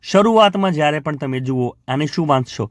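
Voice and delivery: ordinary delivery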